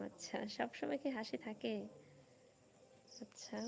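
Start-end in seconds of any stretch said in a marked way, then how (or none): 3.2-3.6 breathy near-silent acknowledgement; soft whispered aside. Real, not acted. Host whispers to herself between viewer comments, very soft. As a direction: drop to a whisper for one word.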